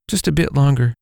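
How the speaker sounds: mother voice. Acted. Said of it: "the mother said".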